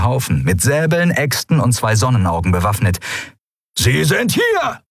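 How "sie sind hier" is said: slow, high bellowed shout; bellowing. Acted. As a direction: roar it at the whole gang. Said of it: "brüllte einer von ihnen".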